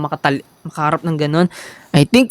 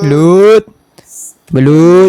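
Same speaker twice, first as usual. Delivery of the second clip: loud drawn-out street vendor call; 7 dB louder, much slower than usual. Pretend. Calling out to customers.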